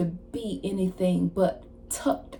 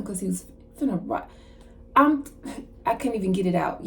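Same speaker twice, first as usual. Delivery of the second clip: ordinary talk, nothing marked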